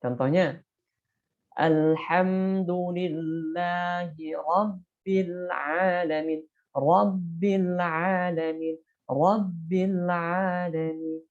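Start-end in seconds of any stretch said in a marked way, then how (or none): 1.6-11.2 slow rabbil alamin with a heavy, rolled ra; thick ra. Shown as a demonstration. Teacher models thick ra in rabbil, slowly. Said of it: "Dibacanya tebal".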